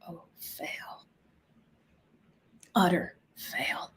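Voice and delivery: very soft, near-whispered verdict; hushed dismay. Retold or imitated. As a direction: breathe it out slowly under your breath. Reacting to the client's admission.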